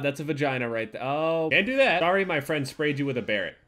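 ordinary delivery

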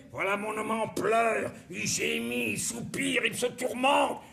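a hammed-up Michel Bouquet rising to a wail. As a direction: swell from calm to grand theatrical anguish. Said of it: "on peut la dire à la Michel Bouquet".

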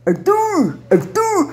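high mock "Arthur" with heavy r; exaggerated pulled r. Woman mocking the ugly over-pulled r, high. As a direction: ham up the drawn r in "Arthur". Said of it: "falar muito puxado é feio".

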